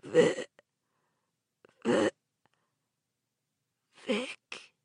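hoarse stammering whisper; croaked whisper. A narrator's reading. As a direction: barely get his name out. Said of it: "she croaked in a whisper".

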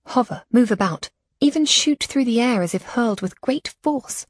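ordinary delivery, nothing marked

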